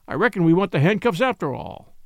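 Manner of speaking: ordinary delivery, nothing marked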